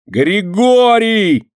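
very high, long-drawn yell; shouting for help. A narrator's reading. Boy shielding himself calls yardkeeper, yelling. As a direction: stretch the name into a loud cry. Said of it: "закричал мальчишка".

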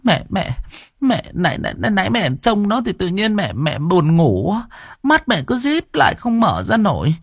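mother telling her son what happened, sobbing. Acted. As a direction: gulp through sobs as she tells it. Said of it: "mẹ nấc lên".

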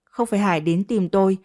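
ordinary delivery, nothing marked

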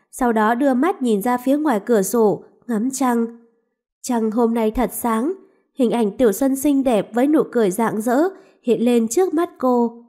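ordinary delivery, nothing marked

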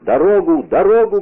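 high, loud demand; shouting. A narrator's reading. Gang leader threatening with pistol to get through, shouting. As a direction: raise the voice to clear a path. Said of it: "кричал он".

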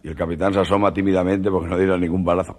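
ordinary talk, nothing marked

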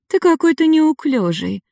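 put-on high teasing girl voice; mocking smirk. A narrator's reading. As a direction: smirk at him, playful. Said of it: "усмехнулась Ада".

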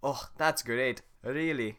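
deadpan the praise; sarcastic Shrek. Acted. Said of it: "Let me try that again".

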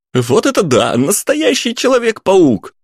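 put-on high, thrilled teen voice; giddy teen. A narrator's reading. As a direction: cheer like an excited kid. Teens cheering the jump.

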